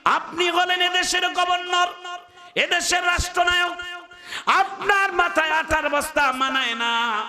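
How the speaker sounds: high, slow chanted protest; calling out. Acted. Aslam protests to the caliph about carrying flour, high chanted call. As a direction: intone the plea on a high held note. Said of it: "আসলাম ডাক দিয়া বলে".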